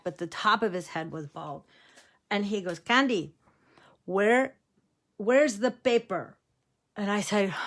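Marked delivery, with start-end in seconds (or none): 2.8-6.4 lightly imitated Mexican-accented grandfather; halting question in a mild Mexican accent; Grandpa Joe. Grandpa asks granddaughter for pepper, light Mexican accent. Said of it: "did have a very heavy accent".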